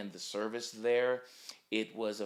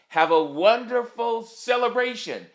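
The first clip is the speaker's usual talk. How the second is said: joyful send-off; 11 semitones higher, 11 dB louder than usual. Real, not acted. Closing wish for the anniversary.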